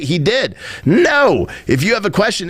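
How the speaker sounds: usual speaking voice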